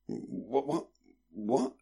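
soft, groggy repeated questioning; foggy, half-aware mumbled question; dazed, growing impatient. A narrator's reading. Brother not grasping his sister's meaning, dazed. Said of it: "still dazed, growing increasingly impatient".

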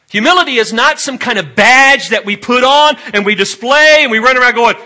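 very loud, high-pitched exhortation; full-force preaching. Real, not acted. Preacher warning congregation against showing off humility, thundering. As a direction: thunder it out to the whole room.

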